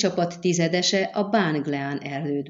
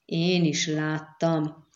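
ordinary delivery, nothing marked

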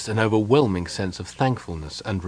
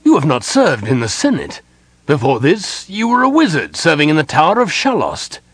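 louder, higher brusque objection; rising brusque protest, louder and higher; 6 semitones higher, 6 dB louder than usual. A narrator's reading. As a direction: cut him down, curt and loud. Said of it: "he stated brusquely".

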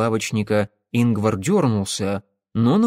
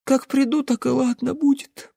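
put-on sleepy child; 14 semitones higher than usual. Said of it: "сонно пробормотала Даринка".

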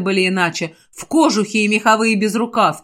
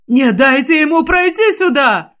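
higher, louder panicked cry; panicked shout; 5 semitones higher, 4 dB louder than usual. Acted. Sabron begs his troops to stop the mage, panicked. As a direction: voice rising toward a shriek. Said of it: "Его голос сорвался на визг".

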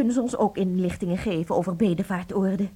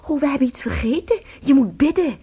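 flustered; 7 semitones higher, 6 dB louder than usual. Acted. Sudden remembered urgency.

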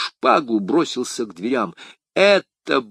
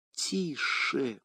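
much softer hushed warning; trembling whisper; 4 semitones higher, 11 dB quieter than usual. A narrator's reading. Madame Bonacieux hushes d'Artagnan, hearing her husband, whispering. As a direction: hush him urgently in a whisper. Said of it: "вдруг прошептала, задрожав".

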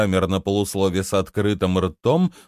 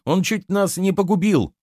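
indignant retort, pitched much higher; flaring up; 10 semitones higher than usual. A narrator's reading. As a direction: flare up without shouting. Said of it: "вскинулась она". (вспылив, высоким голосом)

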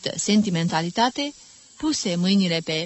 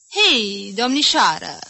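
louder teasing hail; teasing call; 3 semitones higher, 6 dB louder than usual. Acted. Mother teasing her daughter before the suitor, louder. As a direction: call the girl over playfully.

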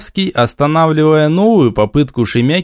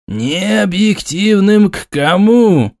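higher, slow indignant question; indignant flare; 7 semitones higher, slower than usual. A narrator's reading. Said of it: "вскинулся Черниговский".

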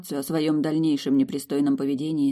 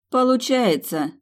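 higher, slow sarcastic reply; 8 semitones higher, 4 dB louder, slower than usual. A narrator's reading. Milly blaming her friends, slow sarcastic. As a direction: let the sarcasm drip. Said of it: "язвительно констатировала Милдред".

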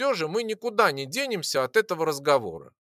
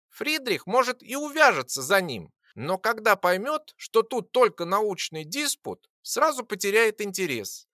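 high little creature voice, knowing; small creature voice; 6 semitones higher than usual. Acted. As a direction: chirp it like a tiny dragon. The small creature Lora speaks.

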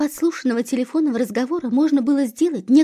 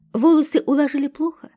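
ordinary delivery

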